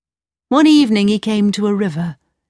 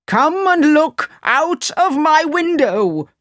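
angry Sultan; 8 semitones higher, slower than usual. Acted. Upset Sultan commands.

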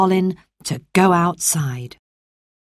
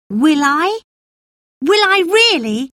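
put-on boy Colin; 11 semitones higher, 3 dB louder than usual. Colin hopeful, surprised.

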